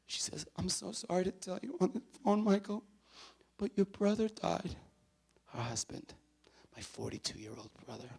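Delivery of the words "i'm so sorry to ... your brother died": high, broken news of a death; acted sorrowful phone voice; imitating tearful sister-in-law. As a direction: deliver it as her through tears, slowing at the end. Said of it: "she's on the line and she's crying".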